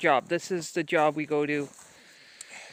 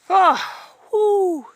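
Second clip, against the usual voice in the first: surprised whoop; 12 semitones higher, 10 dB louder than usual. Real, not acted. Falls or slips in snow.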